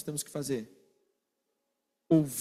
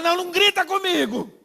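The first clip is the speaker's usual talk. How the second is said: much higher and louder, a hammed-up protest; mock hurt complaint; 11 semitones higher, 6 dB louder than usual. One side of an argument complaining, in mock indignation. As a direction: act offended at being yelled at. Acted quarrel, complaining of shouting.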